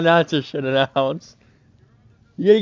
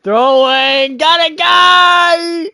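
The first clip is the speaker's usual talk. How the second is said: excited mock call; 13 semitones higher, 8 dB louder, much slower than usual.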